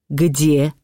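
understated cry of a mother; sudden cry. A narrator's reading. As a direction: demand it word by word. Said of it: "закричала вдруг матушка".

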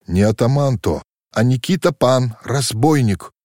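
ordinary delivery, nothing marked

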